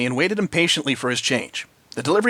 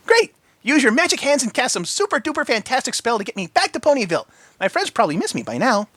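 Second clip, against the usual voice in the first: high pony voice; excited Pinky; 10 semitones higher than usual. A narrator's reading. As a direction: bubble with excitement.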